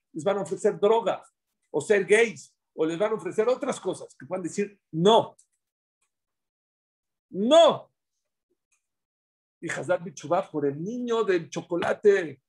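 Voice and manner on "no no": loud high emphatic refusal; a child's firm no, louder and higher. Shown as a demonstration. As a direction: shout a firm no at a stranger. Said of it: "enseñarle a decir a los niños, "No".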